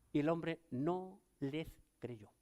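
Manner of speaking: ordinary delivery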